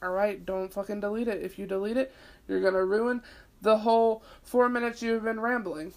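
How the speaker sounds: usual speaking voice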